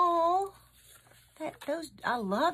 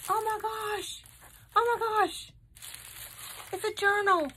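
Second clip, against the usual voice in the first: high, astonished exclamations; high-pitched, overjoyed surprise; amazed delight; 10 semitones higher, 3 dB louder than usual. Real, not acted. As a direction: exclaim in thrilled disbelief. Amazed at seeing the journal.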